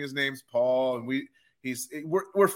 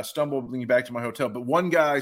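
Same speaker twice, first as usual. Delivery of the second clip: usual speaking voice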